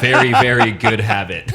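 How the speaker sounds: laughing through the words; amused, laughing agreement. Real, not acted. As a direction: chuckle as you agree. Host laughs while agreeing.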